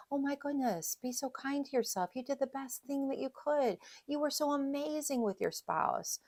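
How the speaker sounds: high, soft, tender reassurance; supportive friend. Acted. Good friend consoling a grieving spouse, tenderly encouraging. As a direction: comfort them gently in a lifted voice. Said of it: "You would tell a friend".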